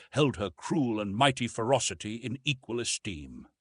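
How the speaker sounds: ordinary delivery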